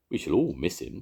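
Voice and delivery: usual speaking voice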